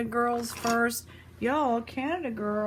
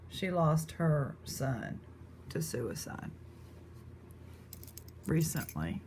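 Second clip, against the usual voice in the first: subdued, sorrowful; 5 semitones lower, 7 dB quieter than usual. Real, not acted. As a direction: let the voice sink quietly over a painful loss. Recounting a relative's family losses.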